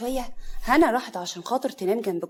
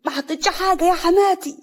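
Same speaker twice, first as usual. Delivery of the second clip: high, loud protest; acted wailing self-defence; indignant denial; 10 semitones higher, 7 dB louder than usual. A narrator's reading. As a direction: protest innocence at the top of the voice. Accused of spoiling the cake.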